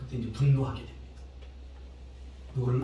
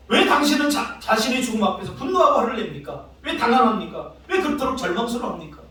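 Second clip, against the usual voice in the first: pressing, higher-pitched reporter's questions; raised voice, demanding an explanation; challenging questioning; 8 semitones higher, 5 dB louder than usual. Retold or imitated. Reporter confronting a dying researcher, challenging.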